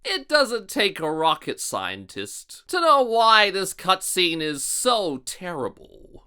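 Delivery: usual speaking voice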